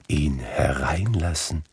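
ordinary delivery

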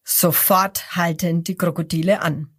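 ordinary delivery, nothing marked

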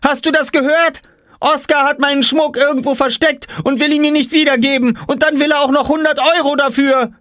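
high, loud tattling shout; loud, high indignant girl's voice; screaming. Acted. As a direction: shout the complaint up high like an outraged little sister. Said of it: "schrie Jule".

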